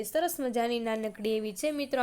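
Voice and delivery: normal talking voice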